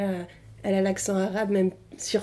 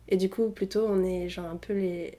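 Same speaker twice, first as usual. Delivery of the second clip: ordinary talk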